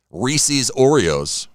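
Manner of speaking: stretched slowly, savoring each word; slow drawn-out. Shown as a demonstration. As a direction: drag out Reese's Oreos slowly. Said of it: "savoring the new snack name".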